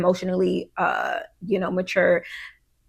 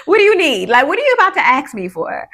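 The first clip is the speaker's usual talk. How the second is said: louder and higher, suspicious mom; mock suspicion; 6 semitones higher, 6 dB louder, faster than usual. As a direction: playfully grill the kid: what are you after. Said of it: "fight the urge to be like".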